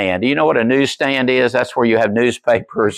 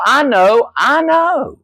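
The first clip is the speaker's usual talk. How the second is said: mock insistence; 12 semitones higher, much slower than usual. Mock insistence on knowing facts.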